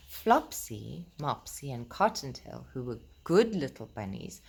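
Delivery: put-on gentle, lilting read-aloud to children; storybook reading. Reading Peter Rabbit aloud to the children, gentle storytelling voice.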